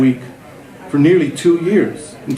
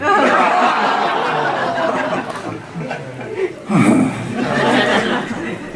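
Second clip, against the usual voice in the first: long sigh melting into a laugh; rueful sighing laugh; 5 semitones higher than usual. Real, not acted. The aging student, no longer Johnny Depp, sighs and laughs. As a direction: sigh out the lost dream, laughing at yourself. Mock dismay at being called grandfather.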